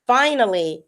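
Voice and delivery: ordinary delivery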